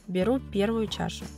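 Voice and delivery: ordinary talk, nothing marked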